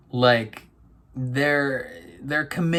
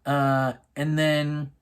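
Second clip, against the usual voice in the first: ordinary delivery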